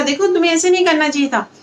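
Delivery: mild scolding. Acted. Villagers lightly scold the boy, quick mild scold. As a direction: chide him gently. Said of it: "थोड़ा डांटते भी उसको हल्का फुल्का".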